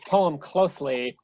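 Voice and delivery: usual speaking voice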